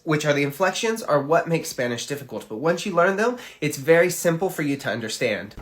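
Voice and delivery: normal talking voice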